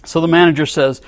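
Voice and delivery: normal talking voice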